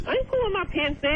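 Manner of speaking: high squeaky voice over the phone; pinched, squeaky chipmunk tone. Real, not acted. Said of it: "That chipmunk voice?".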